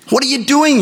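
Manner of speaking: high, alarmed what are you doing; shocked cry. Acted. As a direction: call out in disbelief.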